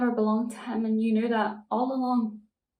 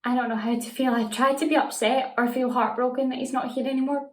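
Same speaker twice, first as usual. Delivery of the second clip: usual speaking voice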